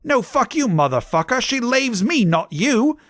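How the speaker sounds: high, hammed-up quarrelling; Vampire's angry shout. A narrator's reading. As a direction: fire back in a high mock shout. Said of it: "shouted Vampire".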